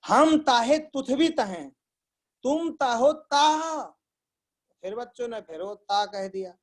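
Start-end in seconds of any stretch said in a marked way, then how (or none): 0.1-3.8 higher, slow lisping outburst; hammed-up anger, words drawn out; mock angry teacher. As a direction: imitate a lisping teacher suddenly losing his temper. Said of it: "एकदम से गुस्सा करके".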